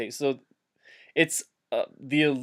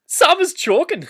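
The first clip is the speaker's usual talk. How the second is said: much higher, louder, squealed alarm; 5 dB louder than usual. Acted. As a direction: squeal it like a panicking hobbit. Pippin yells for help.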